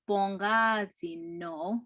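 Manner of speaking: slow, careful "bongazi no"; said slowly. Shown as a demonstration. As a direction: give "bongazi no" slowly so students can follow. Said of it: "So when you put itza".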